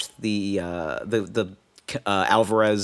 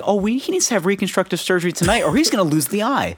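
mock doctor; 9 semitones higher than usual. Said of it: "to the point where it's just like".

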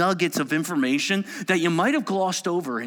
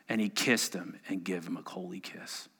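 ordinary talk, nothing marked

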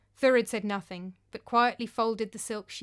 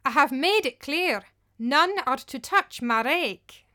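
stern, louder, slow on the last words; stern warning; 5 semitones higher, 7 dB louder, slower than usual. Acted. Thorgunna warns Thorgrima off her rake, stern and slow. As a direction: lay down the law, slowly. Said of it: "looked down into the witch-face's eyes".

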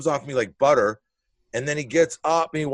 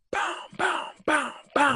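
imitated door banging.

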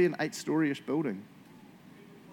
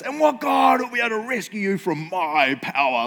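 higher, louder, red-faced fury; angry king; 6 semitones higher, 5 dB louder than usual. Shown as a demonstration. Pastor acts out the furious Nebuchadnezzar for the group, angry and loud. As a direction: spit it out like an arrogant raging king. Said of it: "I always read it like this, 'cause he's angry".

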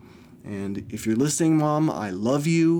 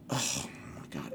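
whispered oh my God; 12 dB quieter than usual. Real, not acted. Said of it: "exasperated aside".

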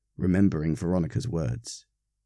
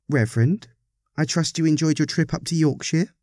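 high, lightly voiced lady with a rising question; pointed polite parishioner; 8 semitones higher than usual. A narrator's reading. Parishioner asking the Reverend about his Yorkshire trip, high and pointed. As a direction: politely probe in a raised character voice.

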